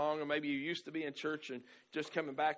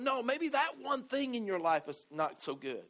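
ordinary talk, nothing marked

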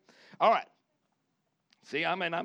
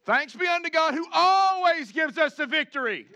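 shouted praise; 9 semitones higher, 8 dB louder than usual. Real, not acted. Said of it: "repeated louder proclamation".

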